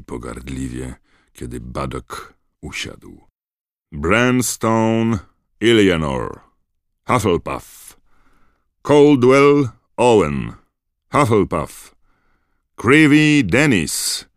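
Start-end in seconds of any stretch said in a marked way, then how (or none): 3.9-14.2 slow, loud, much higher name call; stern roll call. A narrator's reading. McGonagall calling first-years forward one by one, slowly and loudly. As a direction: read each name out to the whole hall.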